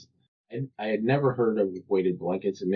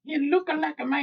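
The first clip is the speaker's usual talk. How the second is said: very high, squeaky skit quote; silly high-pitched catchphrase; comedy skit voice. Retold or imitated. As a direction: imitate a skit character way up high. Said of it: "That's such a s-, great skit too".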